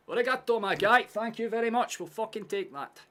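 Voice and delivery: loud, high-pitched triumphant shout; celebrating. Real, not acted. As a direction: shout your thanks in jubilant triumph. Celebrating in the game.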